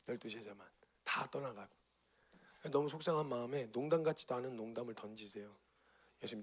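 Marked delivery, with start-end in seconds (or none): 0.1-1.7 softer, whispered aside; hushed whisper. A narrator's reading. Preacher telling how the crowd left, whispered. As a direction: let it fall to a whisper. Sad moment, only twelve left.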